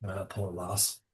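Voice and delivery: put-on dwarf speaks kindly of the girl; low dwarf voice. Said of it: "Yerva says".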